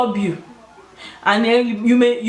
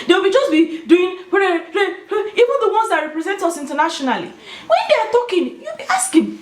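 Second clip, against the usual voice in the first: laughing; 9 semitones higher than usual. Real, not acted. Laughing while mocking.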